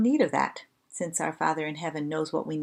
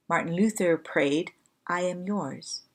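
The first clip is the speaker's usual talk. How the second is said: ordinary talk, nothing marked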